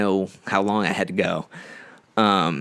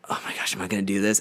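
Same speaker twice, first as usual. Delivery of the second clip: fast nervous whisper; 7 dB quieter, faster than usual. Retold or imitated. Anxious inner thought, whispered.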